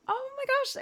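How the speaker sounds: sudden high squeal of delight; surprised. Real, not acted. Streamer reacting to a viewer checking out her song, surprised squeal. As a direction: gasp it out, thrilled and surprised. Excited reaction to a chat message.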